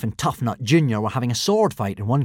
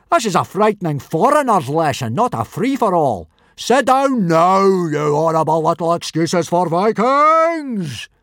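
bellowed order; 8 semitones higher, 6 dB louder than usual. A narrator's reading. Teacher breaking up a brawl, bellowing. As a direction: bawl the class into line.

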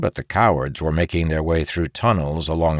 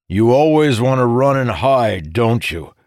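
louder, mocking jibe; taunting; 5 semitones higher, 5 dB louder than usual. A narrator's reading. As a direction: needle him like a bully. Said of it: "Morgidian taunted".

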